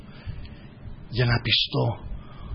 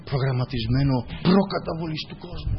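ordinary talk, nothing marked

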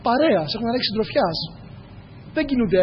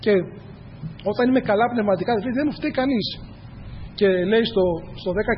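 usual speaking voice

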